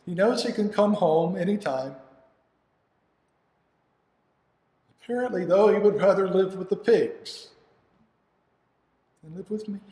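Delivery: a hurt father protesting; hurt indignation. Acted. Hurt father after bad news.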